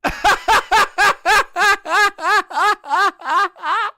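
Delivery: howling with laughter; loud laugh. Real, not acted. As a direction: burst out laughing. Reacting to clip in disbelief.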